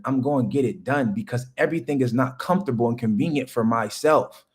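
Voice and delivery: ordinary talk, nothing marked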